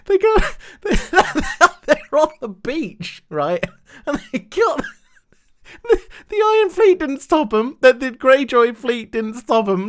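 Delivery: high, breaking up with laughter; laughing while talking. Real, not acted. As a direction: struggle to get the words out through helpless laughing. Cracking up at absurd plot.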